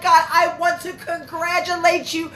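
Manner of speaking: shower her with happy congratulations; gushing congratulations. Real, not acted. Streamer congratulating Lasiah on her new baby, joyful high gushing.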